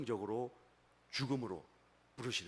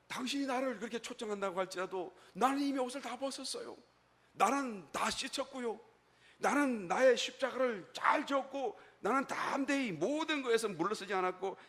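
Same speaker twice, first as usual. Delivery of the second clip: high, emphatic protest of the woman; 여인의 항변; 8 semitones higher, 3 dB louder than usual. Acted. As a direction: protest your readiness, pitch held high. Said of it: "여인이 대답하는 거예요". (높은 음으로 힘주어 항변하는 여인)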